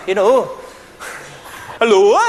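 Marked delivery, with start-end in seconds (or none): 1.8-2.2 a lilting Welsh hello, louder; Welsh greeting. Pretend. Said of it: "maybe the Welsh turn up".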